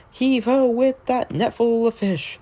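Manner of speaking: put-on high hearty old sea dog calling out; sing-song shanty refrain, hearty; salty sailor voice. Narrator voicing Salty the dock diesel, hearty old sailor.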